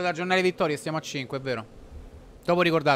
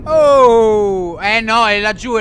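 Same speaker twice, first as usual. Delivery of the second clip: excited shout; 4 semitones higher, 12 dB louder, much slower than usual. Real, not acted. Sudden sighting in game.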